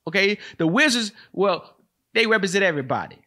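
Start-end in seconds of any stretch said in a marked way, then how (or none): none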